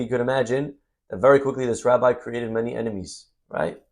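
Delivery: ordinary talk, nothing marked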